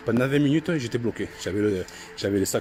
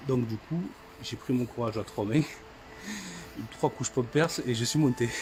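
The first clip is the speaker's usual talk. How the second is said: ordinary talk, nothing marked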